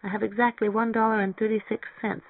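high, small, sheepish admission; crestfallen. A narrator's reading. Mary Ann confesses she cannot pay the deposit, crestfallen. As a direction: own up in a little high voice. Girl dismayed, lacking deposit money.

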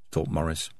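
ordinary delivery

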